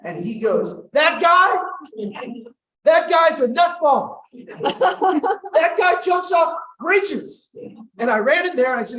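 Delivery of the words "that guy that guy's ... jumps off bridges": high, loud, incredulous outburst; hammed-up yelling denunciation; outraged cousin. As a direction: shout the accusation, stretching it out at the end. Cousin loudly denounces teller to her.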